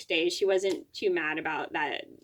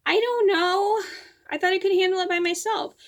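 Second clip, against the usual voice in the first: much higher, louder mock-helpless plea; retold defensive protest; 11 semitones higher, 5 dB louder than usual. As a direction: protest high, imitating yourself being scolded. Retold defence to a scolding mom.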